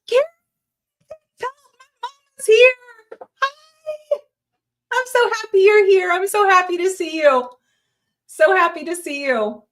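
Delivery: high, loud, delighted greeting; excited. Real, not acted. Greeting her mom joining the live, overjoyed and loud. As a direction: squeal with joy that mom joined.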